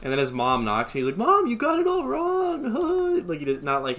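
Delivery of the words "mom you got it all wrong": high, loud, drawn-out protest; hammed-up squealing plea; mock panicked wail. Boy protesting as his mom knocks, mock panicked wail.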